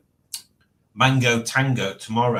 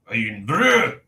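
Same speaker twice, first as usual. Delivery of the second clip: Scottish accent; 3 dB louder than usual. Shown as a demonstration. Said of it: "Does that sound better?".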